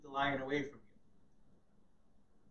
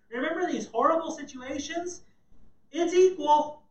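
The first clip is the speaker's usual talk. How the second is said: God's words, much higher and louder; voicing God; 11 semitones higher, 13 dB louder than usual. Preacher voicing God to Israel, loud and high.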